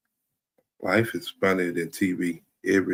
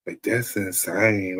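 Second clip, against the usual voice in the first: ordinary talk, nothing marked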